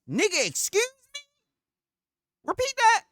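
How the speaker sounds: can't believe what he just heard; retelling a jaw-drop moment; stunned disbelief. Retold or imitated. Retold shock at the rep.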